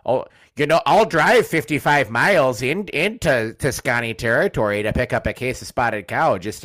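imitating a friend's loud, eager promise; eager mock boast. Friend bragging about driving for beer, loud and eager. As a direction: puff it up like a beer pilgrim bragging.

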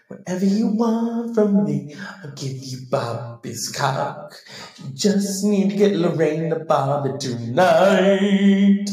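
spooky voice from the other side; ghost voice. Pretend. Dead [name] speaks through medium.

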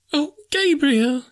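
very high, slow drawn-out wail; terrified scream. A narrator's reading. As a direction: scream in falsetto, about to faint. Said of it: "she screamed and fainted again".

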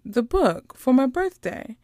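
a hint of a child reminding her aunt; young niece. Niece reminding her aunt of a promise, lightly imitated child. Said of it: "she's about, like, seven or eight".

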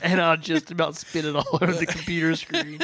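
talking through laughter; laughing. Real, not acted. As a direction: say it while cracking up. Laughing after nearly spitting drink.

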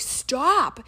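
exasperated. Retold or imitated. Acting own annoyed reply to podcaster.

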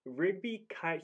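ordinary talk, nothing marked

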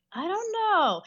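high-pitched dithering quote; unsure, acted-out hesitation. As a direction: waver way up high, mock unsure. Acting out her hesitant reply.

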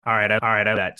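flat, low robot voice. Pretend. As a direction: talk like a robot. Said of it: "you were, what, in a robot voice there".

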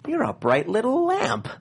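quoting a cartoon compliment with slow, high, drawn-out delivery; film quote. Giving friends a favourite Toaster compliment, slow high movie quote. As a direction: stretch it out slowly, pitched well up. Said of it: "a quote from The Brave Little Toaster".

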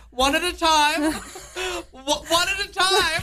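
high, laughing call through the door; stern employee knock. Retold or imitated. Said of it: "send one of the employees out there ... be like".

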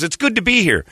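high mock Irish greeting; hamming up a jolly Irishman. [name] greeting the host, mock Irish.

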